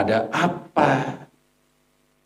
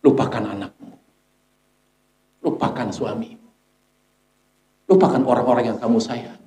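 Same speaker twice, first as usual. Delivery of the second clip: hushed, tender voicing of the angel; gentle angel of death. Angel of death urging the dying to let go, gently.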